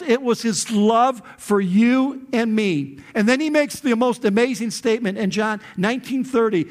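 usual speaking voice

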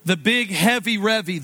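ordinary talk

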